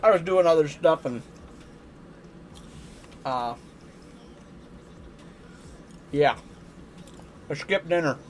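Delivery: talking while crunching dry cereal. Real, not acted. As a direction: mouth full of dry cereal. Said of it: "if you hear me crunching".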